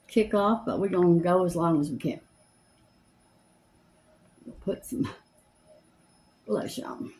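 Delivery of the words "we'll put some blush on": whisper. Real, not acted. Quietly applying blush.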